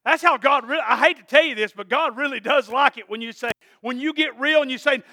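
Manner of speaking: usual speaking voice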